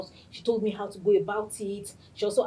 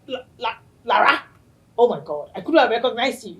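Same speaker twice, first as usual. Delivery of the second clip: louder, brisk surprised imitation; imitating Stephanie; 3 semitones higher, 8 dB louder than usual. Host mimicking her friend not recognizing her, surprised. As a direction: act her stunned recognition. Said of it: "She was like".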